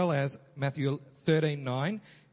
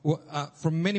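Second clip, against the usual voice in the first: ordinary delivery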